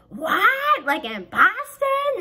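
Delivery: high, loud mock gasp of disbelief; squeaky stunned friend voice; shocked disbelief. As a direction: play the friend who can't believe it. Friend reacting to cowboy news.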